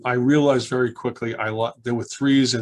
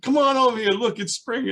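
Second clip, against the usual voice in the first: put-on gather the kids in wonder; high cheerful teacher's call; excited calling; 12 semitones higher than usual. Teacher calls the kids over.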